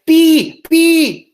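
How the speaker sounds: put-on high, loud baby squeal of a word; little-kid voice, high and loud. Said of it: "as my baby would say".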